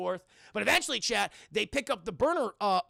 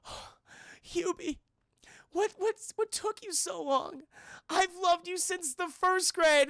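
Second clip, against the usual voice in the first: high, slow, halting lovestruck voice; hammed-up romantic heroine; smitten woman; 10 semitones higher, slower than usual. Woman answers a declaration of love.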